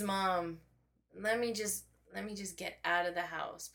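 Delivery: ordinary delivery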